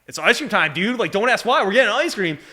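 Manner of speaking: loud, high, excited push; excited insistence. Real, not acted. Telling the young medic it's ice cream time, excited.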